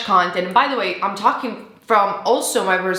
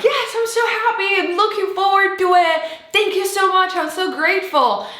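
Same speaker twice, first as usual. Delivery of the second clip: much higher, gushing with joy; overjoyed thanks; 10 semitones higher than usual. Pretend. What you should say back to the spirit, much higher and gushing. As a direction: bubble over with gratitude. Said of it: "instead of being like".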